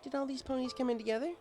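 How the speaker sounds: put-on high, bookish young mare voice, caught off guard; Twilight Sparkle. Twilight puzzled the bartender knows her name, high voice. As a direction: pitch it up as a studious unicorn, puzzled. Twilight asks about the crowd.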